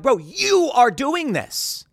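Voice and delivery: high, slow disbelief; incredulous bro. Retold or imitated. To the host he's walking out, slow and incredulous. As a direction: draw it out, stunned. Stunned reaction to the walkout.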